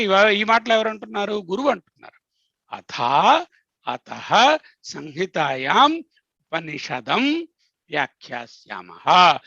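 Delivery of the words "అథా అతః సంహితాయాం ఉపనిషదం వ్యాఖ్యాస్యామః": Vedic recitation. Shown as a demonstration. Teacher quotes the Upanishad line.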